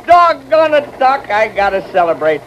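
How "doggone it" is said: usual speaking voice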